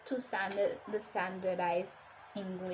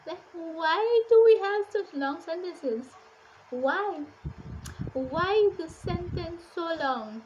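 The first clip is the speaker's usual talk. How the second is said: exasperated reader; 6 semitones higher, 6 dB louder than usual. Acted. Reader complaining about a long-winded classic, exasperated. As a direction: play up the exasperation at endless sentences. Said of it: "That is how I felt while reading this book".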